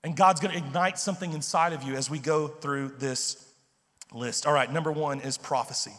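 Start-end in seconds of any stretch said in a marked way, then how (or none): none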